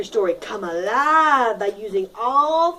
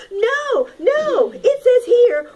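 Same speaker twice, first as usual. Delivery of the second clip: much higher, louder, urgent; mother hen protesting; 10 semitones higher, 6 dB louder, slower than usual. Acted. Mother hen interrupts the ants, much higher and urgent. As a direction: burst in to stop the ending.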